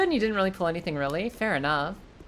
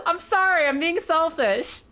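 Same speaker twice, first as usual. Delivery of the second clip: mock apology; 10 semitones higher, 4 dB louder, slower than usual.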